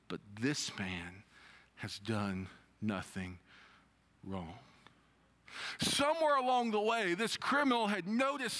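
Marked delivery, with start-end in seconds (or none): 0.1-4.6 low, hushed and slow, fading out; quiet reading. Acted. Preacher reading the criminal's words, hushed. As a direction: let the words sink down to almost nothing.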